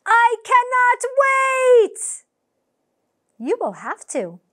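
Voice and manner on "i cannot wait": slow, high, loud shout; excited shout. Acted. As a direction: belt out the impatience. Impatient for the surprise.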